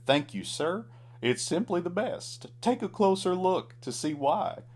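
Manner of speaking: high clown voice, sweetly sly. A narrator's reading. Clown baits cowboy with flower, high sly voice. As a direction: lure him in high and sweet.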